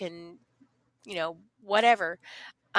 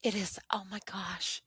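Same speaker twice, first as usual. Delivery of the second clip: whispered awe; 9 dB quieter than usual. Real, not acted. Overcome talking about rewards.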